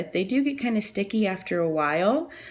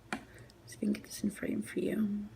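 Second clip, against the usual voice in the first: softer, whispered camera fix; whispered aside; 2 semitones higher, 8 dB quieter than usual. Real, not acted. Whispering to viewers while framing the shot. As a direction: say it under your breath while fiddling.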